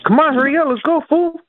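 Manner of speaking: impatient caller act; mock impatience. Prank caller pretends to be impatient.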